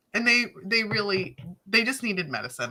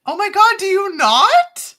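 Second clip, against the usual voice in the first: high, loud, drawn-out mock shock; theatrically appalled, pitch soaring; incredulous outburst; 11 semitones higher, 8 dB louder than usual. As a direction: exclaim it slowly in loud disbelief.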